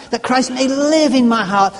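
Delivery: "live" lifted and punched to set it apart; stress on live. Shown as a demonstration. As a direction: hit the word "live" as the key meaning. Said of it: "Not just pass".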